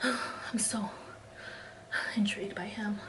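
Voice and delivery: sighs first, then whispers "I'm so intrigued by him" in a breathy voice, mocking Bella; mock Bella's breathy whisper, sighing first. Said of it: "I hate that breathy thing she does".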